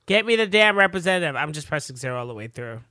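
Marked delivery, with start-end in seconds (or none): 0.1-1.4 louder, irritated demand; annoyed shout. Retold or imitated. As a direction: bark at the phone menu. Yelling at phone menu.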